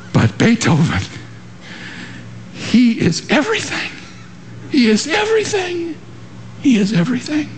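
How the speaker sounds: rising awe. Retold or imitated. Toscanini exalting Beethoven.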